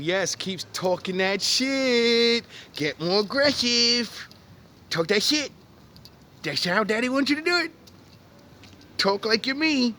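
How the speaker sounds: lightly voiced father urging aggression; father's voice. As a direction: urge them on like a proud dad. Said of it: "That's my daddy saying".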